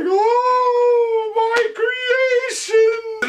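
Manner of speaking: very high, drawn-out mock wail; overcome mad scientist. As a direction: wail it slowly like a hammy creator. Acting scientist crying over creation.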